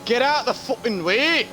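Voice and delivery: high, loud northern shout; northern accent shout. Retold or imitated. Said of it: "shouting down the mic in his northern accent".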